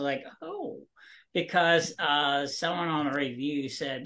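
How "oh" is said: soft, high-pitched surprised "oh"; small retold "oh" of surprise, pitched high. Author recalling reaction to a review, surprised. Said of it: "because I was surprised".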